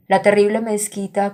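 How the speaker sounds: ordinary talk, nothing marked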